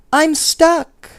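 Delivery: high, loud, unusual little bear voice; complaining toy bear, stuck; teddy bear voice. A narrator's reading. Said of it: "yelled the teddy bear".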